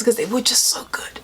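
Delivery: breathy whispered confession; whispered fond aside. Real, not acted. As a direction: whisper it like a guilty pleasure.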